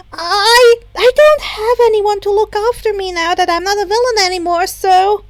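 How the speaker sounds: put-on high, loud, eager girl voice; a hyper girl begging to be adopted; bubbly Himiko. A narrator's reading.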